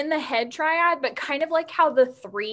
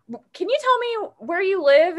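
concerned caring voice; 8 semitones higher, 6 dB louder than usual. Retold or imitated. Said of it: "she's like".

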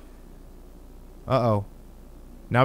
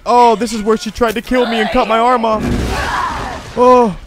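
loud, high-pitched reaction to a remembered attack; excited recognition; 8 semitones higher, 8 dB louder than usual. Real, not acted. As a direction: call it out, higher and louder. Relives attack scene in game.

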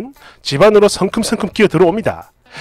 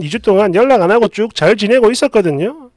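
plain reading voice

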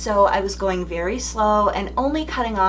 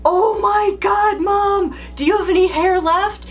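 shocked daughter; 12 semitones higher, 5 dB louder than usual. Retold or imitated. Daughter seeing burned hair on FaceTime, shocked high cry. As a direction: imitate the daughter's horrified gasp on FaceTime. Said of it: "she's like".

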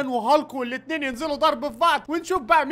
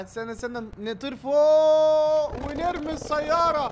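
usual speaking voice

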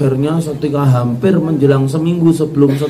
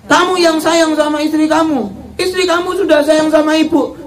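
high, scolding mother-in-law sending her son out; 11 semitones higher than usual. Acted. Mother-in-law scolds her son, high and firm. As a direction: voice the stern mother choosing her daughter-in-law.

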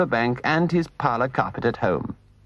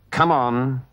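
grim. Acted. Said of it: "he said grimly".

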